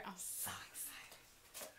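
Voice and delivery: slow, hushed whisper of delight; excited whisper. Real, not acted. Vlogger whispering her excitement over the shower gel. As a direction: whisper it slowly, too thrilled to speak up.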